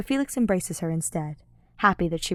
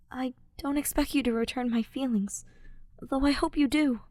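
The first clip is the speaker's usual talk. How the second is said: hushed, higher, tender voice of Ash; soft whisper; 6 semitones higher than usual. A narrator's reading. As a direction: let it fall softly, smiling. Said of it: "he whispers with a soft smile".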